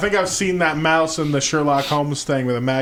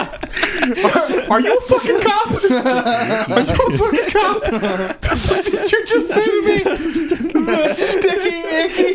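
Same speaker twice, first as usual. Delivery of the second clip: much higher, laughing hammed-up indignation; mock outrage; 13 semitones higher, slower than usual. Jokingly accusing friend of being a cop, shrill laughing mock outrage. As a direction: screech it like an offended cop-hunter.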